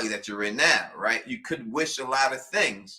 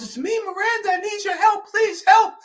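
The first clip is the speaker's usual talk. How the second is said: very high, shouting woman's plea; man doing a woman's voice; 6 dB louder than usual. Pretend. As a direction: shriek it as a desperate wife. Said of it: "does the imitation of his wife's voice".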